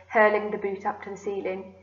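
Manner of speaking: usual speaking voice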